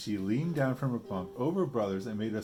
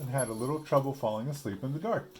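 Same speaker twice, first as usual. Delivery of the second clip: excited; 4 semitones higher, 6 dB louder than usual. Acted. Papa finds his nightlight.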